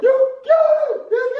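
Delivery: very high-pitched shrieking words; hysterical scream. Acted. Reacting to the dropped fish, hysterical scream. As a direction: shriek it in full panic.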